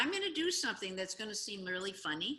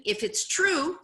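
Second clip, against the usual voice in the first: usual speaking voice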